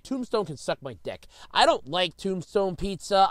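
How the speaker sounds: ordinary talk, nothing marked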